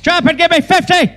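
barking high drill sergeant. Pretend. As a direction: shout orders at recruits. Drill sergeant role shouting.

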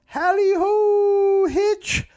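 very high, drawn-out holler; calling out. Acted. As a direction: sing out a long falsetto hail. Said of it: "he saw a figure call".

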